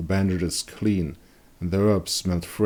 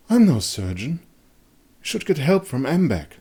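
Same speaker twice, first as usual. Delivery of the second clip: higher, lightly voiced alarmed woman; Irmgard's voice; 9 semitones higher than usual. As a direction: push back, a touch alarmed, voiced up. Irmgard protests, aghast.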